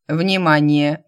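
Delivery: loud, metallic announcer voice; booming metallic robot. A narrator's reading. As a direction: boom it like a ship's computer. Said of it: "зазвучал металлический голос".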